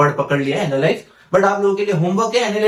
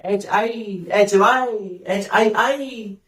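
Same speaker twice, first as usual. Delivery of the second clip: slow spelling demo; 3 semitones higher, much slower than usual. Shown as a demonstration. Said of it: "WhatsApp पे लिखते नहीं हो हाय".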